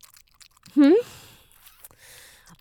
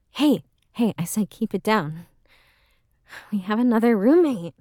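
normal talking voice

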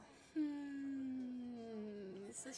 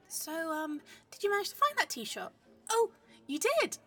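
high and loud, playing the cheerful tourist; breezy fake delight, much higher and louder; bright loud cover chatter; 4 semitones higher, 11 dB louder than usual. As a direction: sell the act to anyone listening. Loud cover talk for watchers.

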